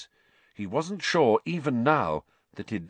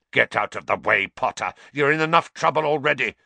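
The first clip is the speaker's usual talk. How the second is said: snarling; 3 semitones higher, 5 dB louder, faster than usual. Acted. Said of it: "snarled Snape".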